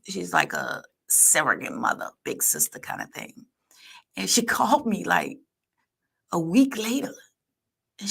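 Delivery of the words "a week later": normal talking voice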